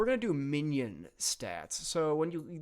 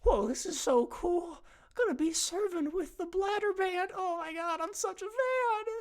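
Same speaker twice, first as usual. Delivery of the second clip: much higher, gushing starstruck voice; fanboy excitement; 10 semitones higher than usual. Acted. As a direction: squeal like a nervous superfan. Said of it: "one of them says".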